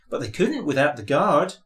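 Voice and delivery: ordinary delivery, nothing marked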